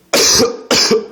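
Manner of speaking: a dry unvoiced cough; real cough. Real, not acted. Vlogger coughing mid-story, real cough. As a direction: clear a tickly throat with a cough.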